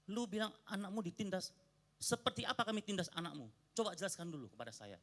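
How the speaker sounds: retold angry reply. Pastor challenging the guest over the bullying claim, angry. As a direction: demand he explain himself, riled up. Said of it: "Saya emosi langsung bilang".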